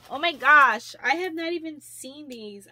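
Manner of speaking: real excited shout of joy; delighted exclaim. Real, not acted. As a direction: blurt it out, thrilled. Discovering new earrings.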